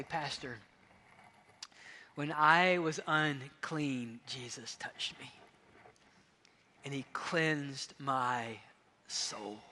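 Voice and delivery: soft, slow and heartfelt; tender. Retold or imitated. Gaius speaking tenderly to his pastor, softly and slowly. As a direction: let each phrase settle gently.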